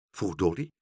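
ordinary delivery, nothing marked

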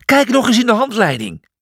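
high, impatient yell; shouted order. Acted. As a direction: call it over the storm.

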